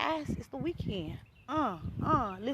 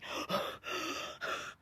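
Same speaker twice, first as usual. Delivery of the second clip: demonstrated winded gasps; out-of-breath gasping; 10 dB quieter than usual. Shown as a demonstration. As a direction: gasp as if out of breath. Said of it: "'cause I'm out of breath".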